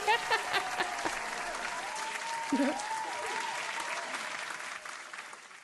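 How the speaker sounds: breathless wheeze of laughter; silent wheezing laugh. Real, not acted.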